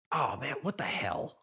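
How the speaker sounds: whispered under the breath. Retold or imitated. Muttered curse at home.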